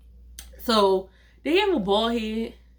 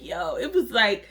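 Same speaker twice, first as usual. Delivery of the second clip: ordinary talk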